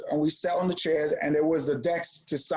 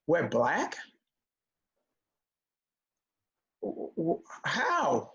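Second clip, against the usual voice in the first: stunned disbelief; 3 dB quieter, much slower than usual. Retold or imitated. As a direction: stammer it out like a bewildered kid.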